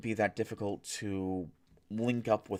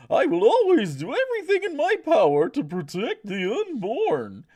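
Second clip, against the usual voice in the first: very high, slow, loud clown voice; 10 dB louder, slower than usual. Acted. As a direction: read it like a goofy circus clown. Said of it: "I'm gonna read this with a clown voice".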